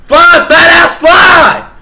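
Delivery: high, loud furious yell; imagined shout. Retold or imitated. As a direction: scream it at him in your head. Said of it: "In my mind, I'm going".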